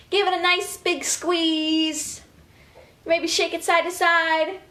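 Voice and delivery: ordinary talk, nothing marked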